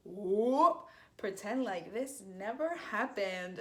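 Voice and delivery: slow, sheepish whoops; sheepish cover-up. Retold or imitated. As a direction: play it off slowly like nothing happened. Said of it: "And I was like".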